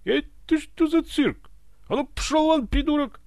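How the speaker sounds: very high, loud outraged shouting; alarmed, angry yelling. A narrator's reading. Ivan drives the servants from his bed, alarmed yelling. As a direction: yell them out of the room. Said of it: "заорал испуганный Иван".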